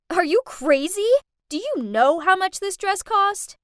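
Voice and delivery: loud, high furious screech; screaming. Acted. Jasmine screaming at the maid about her dress, furious. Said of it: "She pushed my mom and screamed".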